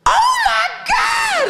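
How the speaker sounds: very high, drawn-out shriek; mock scream. Retelling the old woman's discovery, high drawn-out mock scream. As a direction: imitate a horrified woman shrieking, stretched slow. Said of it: "the loudest sound I've ever heard".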